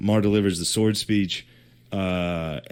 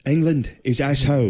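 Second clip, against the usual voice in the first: put-on high, loud Irish accent; hammed-up Irish "England is asshole"; mock Irish accent; 6 semitones higher, 3 dB louder than usual. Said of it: "in that fucking Irish accent".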